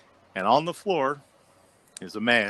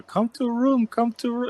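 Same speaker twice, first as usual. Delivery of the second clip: high panicked call; 3 dB louder than usual. Retold or imitated. Repeating a frantic housekeeper's call in a high voice. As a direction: voice jumps way up, urgent and pleading.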